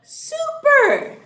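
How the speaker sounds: high, loud cheer; excited praise. Real, not acted. Praising the children.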